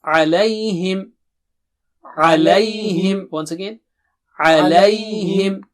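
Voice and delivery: Alayhim drawn out slowly; slow model alayhim. Shown as a demonstration. Teacher drills alayhim slowly and clearly. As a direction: stretch alayhim for learners. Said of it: "repeat after me".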